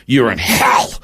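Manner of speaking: harsh mock yell rising on hell; taunting yell. Another man in the dark telling him where he is, harsh taunt. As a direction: throw it at him, hammering hell. Retold tormentor announcing hell.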